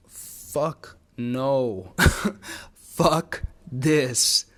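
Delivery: drawn-out emphatic refusal breaking into a laugh; emphatic mock roar with a laugh; retold inner roar. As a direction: voice the body's outraged roar, half laughing. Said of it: "like, roared inside of me".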